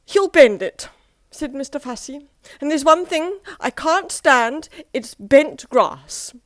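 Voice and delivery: be scandalised at the very thought; Mister Fussy appalled at Mister Happy's request; prissy horror. Acted. Fussy fretting about grass.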